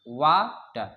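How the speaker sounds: "water" broken as wa, pause, ter; split into syllables. Shown as a demonstration. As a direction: pause midway through "water". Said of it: "फा पे रुकना पड़ता है".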